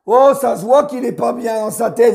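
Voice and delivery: ordinary talk, nothing marked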